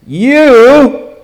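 "you" suddenly loud and high; loud, drawn-out stress on YOU; emphatic. Acted. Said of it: "it's emphatic".

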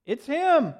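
high, loud cry of recognition; crowd's acclaim. Acted. Crowd hailing Jesus as king, high loud cry. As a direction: shout it out like the crowd. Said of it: "They, they said it".

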